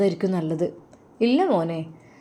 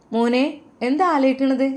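higher, louder fond call to her son; mother's voice; 7 semitones higher, 4 dB louder than usual. Acted. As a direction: call him gently as his mother. Mother calls pensive son.

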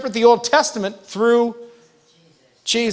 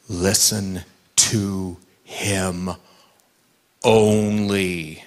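much lower, very slow voice from heaven; voice of God; 3 dB quieter, much slower than usual. Acted. Preacher voicing God correcting Peter, very low and slow. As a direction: speak as God from the cloud, each word slow and low. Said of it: "God had to shout from heaven".

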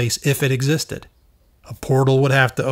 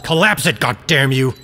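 furious shout; 3 dB louder than usual. Acted. Commander raging at Sam to collapse it, angry shout. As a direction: curse him out as you command.

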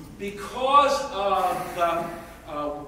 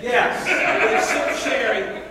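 laughing, mock-confirming; 3 semitones higher, 7 dB louder, slower than usual. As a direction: own the joke with a laugh. Laughing repeat of stereotype.

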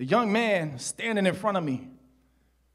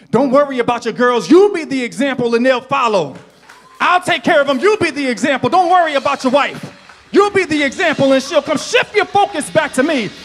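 high, loud impassioned preacher's shout; full-force preaching; 12 semitones higher, 13 dB louder than usual. Real, not acted. Preacher urging the church to refocus on God, full-force preaching. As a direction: cry it out to the whole congregation.

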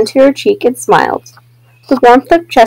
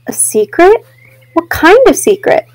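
put-on curious child; 6 semitones higher than usual. Chester curious about secret.

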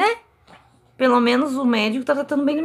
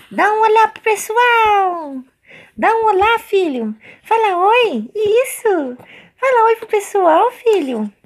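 much higher and louder sing-song coaxing; 11 semitones higher, 7 dB louder, slower than usual. Real, not acted. Mother urging her baby to greet viewers, loud high baby talk.

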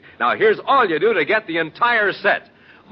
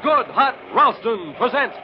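booming radio announcer intro; announcer opening; 5 semitones higher than usual. Acted. Announcer opening the radio show with a booming title call.

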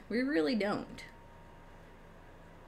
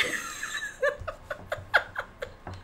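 squealing laugh; 4 dB quieter than usual. Real, not acted. Laughing after apology.

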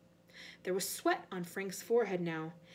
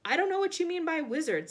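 loud, high, indignant retort; defiant, growing steadier; 7 semitones higher, 9 dB louder than usual. A narrator's reading. Frank standing up to the voice, loud defiance. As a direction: stand your ground loudly. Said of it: "his voice growing steadier".

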